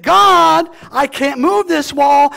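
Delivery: loud, high cry to God; retold plea shouted high; desperate cry. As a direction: beg God at the top of your voice. Pastor voices his own desperate prayer.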